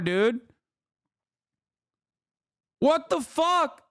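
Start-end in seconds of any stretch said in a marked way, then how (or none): 2.8-3.8 high, slow incredulous cry; exasperated outburst. Real, not acted. Streamer ranting at transphobes, exasperated.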